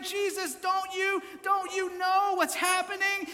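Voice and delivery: high-pitched panicked plea; mock-frantic, high urgent appeal; freaking out. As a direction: act out disciples waking Jesus in alarm. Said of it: "they're freaking out".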